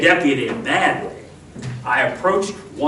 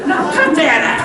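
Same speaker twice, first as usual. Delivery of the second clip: laughing scold; 12 semitones higher, 3 dB louder than usual. Acted.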